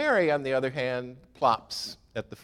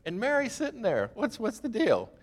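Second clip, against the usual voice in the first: higher, exasperated mock complaint; irritated Martha; 11 semitones higher than usual. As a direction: throw up your hands at the lazy sister. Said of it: "she's getting a little irritated".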